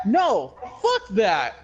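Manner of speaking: laughing angry rant. Real, not acted. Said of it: "I was very angry about this".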